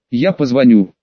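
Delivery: usual speaking voice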